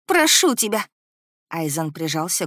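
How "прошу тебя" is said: high, loud begging; pleading cry. A narrator's reading. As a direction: cry out, imploring him. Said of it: "закричала я с мольбой в голосе". (кричать с мольбой)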